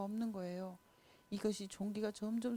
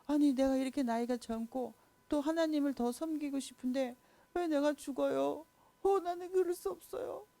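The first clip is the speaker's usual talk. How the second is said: high, rising alarmed protest; shocked young king begging to live; pleading king; 6 semitones higher than usual. Acted. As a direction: cry out in disbelief as the young king. King protests his death.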